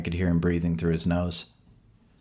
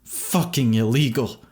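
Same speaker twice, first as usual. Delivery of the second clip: slow, loud growl through teeth; 5 semitones higher, 4 dB louder, slower than usual. A narrator's reading. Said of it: "practically growling through his teeth".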